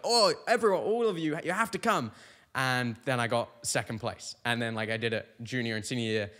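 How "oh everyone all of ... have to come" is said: high, bright mock-eager call to everyone; eager invitation. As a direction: beg all your friends to come, a bit hammed-up. Retold eager invite to friends.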